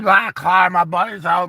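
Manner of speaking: mock drunk rambling at a cop; obnoxious drunk. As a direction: ham up a wasted buddy mouthing off. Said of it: "acting super obnoxious".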